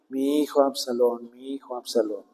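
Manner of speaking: voicing a grieving father, fading to near silence; mild tearful lament. David grieving his son, quietly imitated lament. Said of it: "el rey David lloraba y decía".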